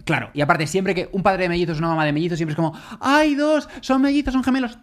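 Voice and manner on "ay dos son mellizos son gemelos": high, gushing mock excitement; imitating people cooing at twins; gushing over twins. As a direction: coo like a delighted passer-by.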